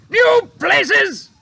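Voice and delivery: high, loud disbelieving shout; head of the Air Force shouting at pilot in disbelief. A narrator's reading. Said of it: "shouted the head".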